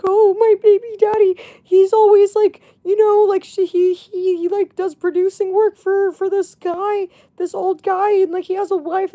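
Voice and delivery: imitating her friend. Imitating her friend calling about the baby daddy, high and excited. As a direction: mimic the friend spilling gossip. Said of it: "she's like".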